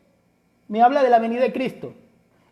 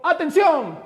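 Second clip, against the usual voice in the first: military command; 4 semitones higher, slower than usual. Retold or imitated. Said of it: "voz de mando es cuando usted está sirviendo en el ejército".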